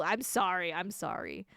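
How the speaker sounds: ordinary talk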